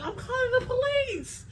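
very high mock neighbor voice; neighbor threat. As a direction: squeal it like the neighbor.

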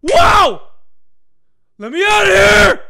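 loud high terrified yells; panicked screaming. Real, not acted. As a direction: scream in panic while fleeing. Chased in game, panicking.

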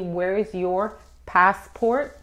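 slow, separated "Where is your passport?"; slow model phrase. Shown as a demonstration. Teacher modelling the question for learners, slow and deliberate. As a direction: take "Where is your passport?" slowly, word by word.

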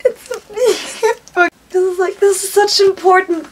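high, tearful, breaking into a laugh; really crying while trying to explain; emotional. Real, not acted. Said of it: "getting so emotional over a cookbook".

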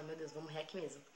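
soft murmur to yourself; hushed dread. Retold or imitated. Girl expecting to die, hushed resignation. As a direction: voice a fearful thought very quietly. Same frightened thought, retold.

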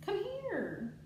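high calling come here; calling pet. Real, not acted. Calling her bunny Rico over, high coaxing call.

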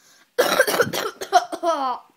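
hacking cough after a bad taste; loud coughing fit. Real, not acted. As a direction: cough it up loudly.

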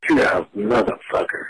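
ordinary talk

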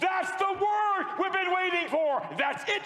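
high, loud prophetess exclaiming; ecstatic outburst. Retold or imitated. Woman preacher hearing the awaited word, ecstatic shout. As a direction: burst out high and thrilled.

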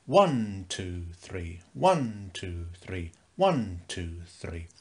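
waltz count, lean hard on "One" and drop "two, three"; stress on one. Shown as a demonstration.

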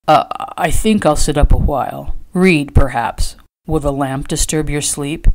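mildly hesitant, uneasy man; stammered. A narrator's reading. Trader startled by her touch, stammering mildly. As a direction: falter slightly, searching for an excuse. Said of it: "he stammered".

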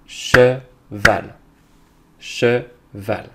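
Cheval split into che and val with a clear gap; slow, segmented Cheval for a learner; syllable by syllable. Shown as a demonstration. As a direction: beat out Cheval in two separate syllables. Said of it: "on va frapper les syllabes".

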